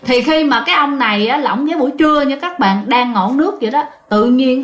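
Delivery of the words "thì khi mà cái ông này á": ordinary delivery, nothing marked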